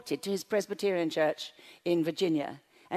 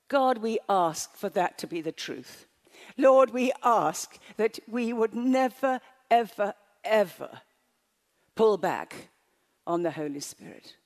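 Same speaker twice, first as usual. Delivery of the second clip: emphatic, weighted prayer with deliberate pauses; fervent prayer; 4 dB louder than usual. Real, not acted. Preacher praying over the congregation, fervent prayer. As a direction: pray it out firmly, landing hard on each key word.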